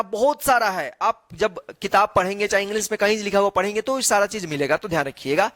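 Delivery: normal talking voice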